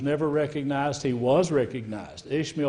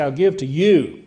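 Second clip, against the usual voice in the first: "you" punched louder with a falling finish; emphatic you; 6 dB louder than usual. Shown as a demonstration. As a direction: say it as if pointing at him on "you". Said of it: "We have body language. We would say".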